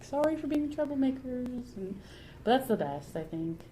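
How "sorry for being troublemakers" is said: high, sing-song mock apology; Greg. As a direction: apologize in a raised, put-on voice. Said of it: "and Greg's like".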